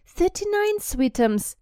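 mother giving the total sweetly; sweet doting mother. Acted. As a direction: coo the answer to the child. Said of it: "said Aunt Petunia".

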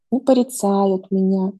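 ordinary talk

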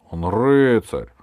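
громкий протяжный выкрик шута; заступись, споря во весь голос; возражение. A narrator's reading. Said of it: "возражал шут". (громкое возражение)

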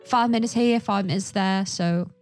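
ordinary delivery

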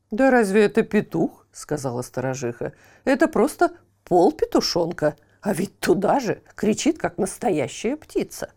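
wife sneering at the rooster; scoffing wife. Acted. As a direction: scoff at the half-chick.

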